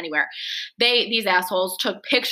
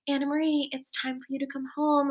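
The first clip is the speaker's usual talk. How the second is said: higher, softer sweet motherly call; sweet mom voice; 5 semitones higher, 7 dB quieter than usual. Retold or imitated. Mom sweetly calling her daughter home, softer and higher. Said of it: "my mom sweetly calls me".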